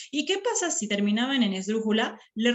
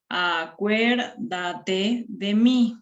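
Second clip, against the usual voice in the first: "Acuérdate de mí" very slow, each syllable separate; syllable-by-syllable demonstration; counting syllables; 3 dB louder, much slower than usual. Shown as a demonstration. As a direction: stretch out A-cuér-da-te de mí for counting. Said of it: "hemos contabilizado las sílabas".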